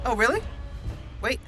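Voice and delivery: ordinary talk, nothing marked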